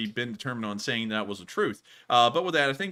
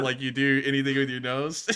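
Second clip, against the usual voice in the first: usual speaking voice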